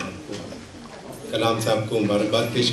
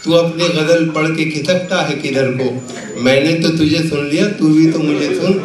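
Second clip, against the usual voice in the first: measured, projected Urdu verse recital; comic poet reciting with rhythmic phrasing; mushaira recitation; 7 semitones higher, 7 dB louder than usual. Acted. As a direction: declaim the couplets to a live audience, line by line.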